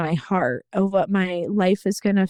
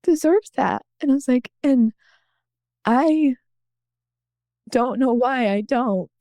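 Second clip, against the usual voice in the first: higher, halting, long pauses; close to tears; 5 semitones higher than usual. Real, not acted. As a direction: struggle to get it out, tearful. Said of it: "It's probably gonna make me cry again".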